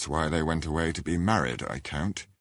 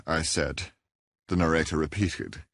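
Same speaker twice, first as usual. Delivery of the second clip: plain reading voice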